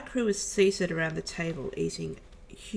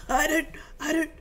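high, agonised stammer getting louder; hammed-up cramping agony; strained, pained voice; 11 semitones higher, 6 dB louder than usual. A narrator's reading. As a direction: act out gripping pain, pitched way up. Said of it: "his voice training".

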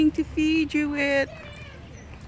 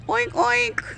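high oink oink like a pig; pig oink imitation; 9 semitones higher than usual. Oinking at the sick pig to get its attention, high mock pig sound. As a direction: squeal the oinks at the pig.

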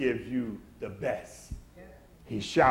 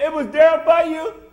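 father pleading warmly; 10 semitones higher, 5 dB louder than usual. Retold or imitated. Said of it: "His dad said".

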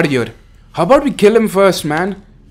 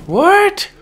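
very high, rising yelp of disbelief; high-pitched incredulous question; shocked; 14 semitones higher than usual. Real, not acted. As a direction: squeak it out in shock. Shocked by death in game.

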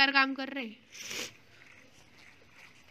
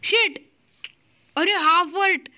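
higher-pitched startled outburst; alarmed cursing; 5 semitones higher, 4 dB louder, slower than usual. Real, not acted. Player is down to half a heart, alarmed cursing. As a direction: swear in sudden panic. Player loses health in game.

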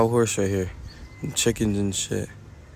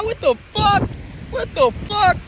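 high, loud shocked exclaim; 3 dB louder than usual. Real, not acted. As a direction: blurt it out in real shock.

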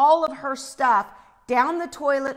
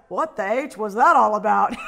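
louder, imitated amused disbelief; amused bystander; 6 dB louder than usual. Imitating a guy laughing at the gate, amused disbelief. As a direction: ask it as a laughing onlooker. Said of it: "they were laughing".

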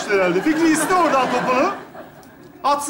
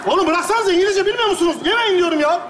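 high, raised scolding voice; exasperated scolding; 6 semitones higher than usual. Acted. Paşa scolds the others for eating, exasperated. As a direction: scold them in exasperation, pitched up.

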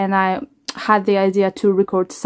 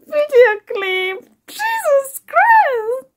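high, breaking voice through happy tears; overwhelmed, voice cracking as tears come; crying with joy; 13 semitones higher, 3 dB louder, slower than usual. Real, not acted. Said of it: "I said I'm not gonna cry".

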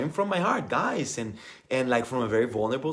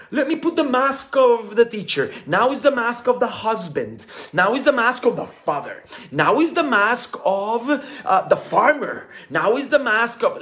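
raised, louder listing of roles; mock mask-wearer; 7 semitones higher, 4 dB louder than usual. Coach mocking himself switching masks, higher and louder. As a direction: rattle off the masks like a performer. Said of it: "You don't have to put".

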